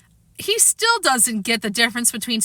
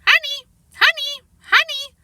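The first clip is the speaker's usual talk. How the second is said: high squawky bird repeating honey; parrot voice; 3 dB louder than usual. Acted. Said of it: "Uncle Feather started".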